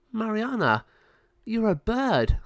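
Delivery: high-pitched amazed discovery; gasping disbelief, voice lifted high; astonished. Acted. Lama Sabio finding Mariana transformed, astonished.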